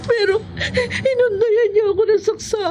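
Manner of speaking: distressed witness defending herself; crying. Acted. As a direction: sob out the account. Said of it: "Huwag ka nang umiyak".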